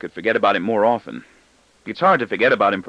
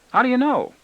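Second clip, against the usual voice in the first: put-on boy's voice. A narrator's reading. Boy voiced by narrator.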